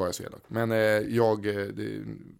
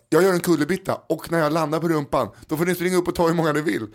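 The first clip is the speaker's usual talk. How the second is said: loud announcement, fast at the end; announcing to kids; 6 semitones higher, 5 dB louder than usual. Retold or imitated. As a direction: hype up a hall of children. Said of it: "Jag bara".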